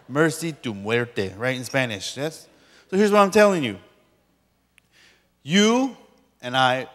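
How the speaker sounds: ordinary talk, nothing marked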